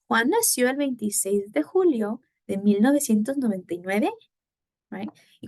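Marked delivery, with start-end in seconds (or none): 0.1-4.1 whole question with "nueve" going up; rising question intonation. Shown as a demonstration. Teacher saying it all together with students, rising intonation. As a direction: lead the group through it, lifting "nueve" at the end. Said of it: "Now, all together".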